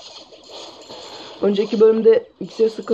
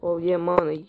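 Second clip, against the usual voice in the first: normal talking voice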